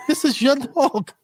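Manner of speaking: high, slow indignant reply; high, drawn-out retort to the wife about her dog. Retold or imitated. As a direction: push back that it's her dog. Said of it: "I'm like".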